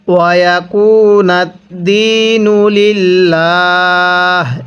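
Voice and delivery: ওয়ায়াকুনাদ্দিনু লিল্লাহ slowly with long vowels; measured Quranic phrase; slow recitation. Shown as a demonstration. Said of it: "এরকমভাবে শিখব".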